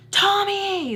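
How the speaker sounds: high, loud mocking yell; sudden scary holler, stretched out high; retold scream. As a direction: imitate him screaming the name in her face. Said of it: "he screams in my face".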